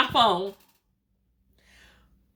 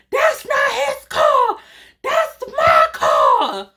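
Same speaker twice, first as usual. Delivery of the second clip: angry shout; 12 semitones higher, 3 dB louder, much slower than usual. Retold or imitated. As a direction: shout her furious claim, word by word. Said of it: "she was pissed".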